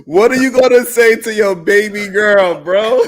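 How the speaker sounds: high, loud, laughing through the question; can't get the words out for laughing; laughing disbelief. Real, not acted. Laughing as he asks Sway what he'll tell his daughter.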